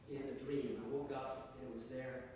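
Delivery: plain reading voice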